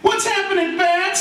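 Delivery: very high, gleeful hail; joyful greeting. Acted. Roy reaches the guru at last, joyful high greeting. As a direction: greet the guru with hammed-up joy. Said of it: "says Roy with joy".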